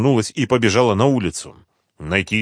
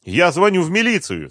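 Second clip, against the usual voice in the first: high, desperate protest; urgent, pitched well up; despairing; 9 semitones higher than usual. A narrator's reading. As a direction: insist in despair. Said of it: "с отчаянием в голосе сказала Света".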